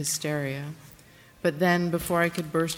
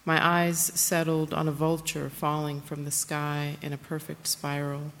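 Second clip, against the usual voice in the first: ordinary delivery, nothing marked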